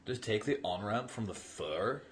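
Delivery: slow mock Californian accent; Californian sketch accent. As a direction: stretch the vowels like a spoofed Angeleno accent. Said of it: "they just talk with that bizarre accent".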